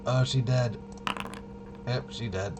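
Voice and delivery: ordinary talk, nothing marked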